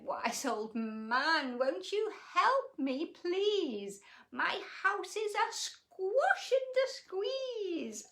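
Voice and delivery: put-on high, begging old lady; old lady pleading. A narrator's reading. Old lady begging the wise old man for help, high and pleading. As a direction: plead with him, drawn out. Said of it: "Oh, she's pleading".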